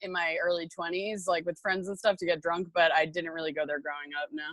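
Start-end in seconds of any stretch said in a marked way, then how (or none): none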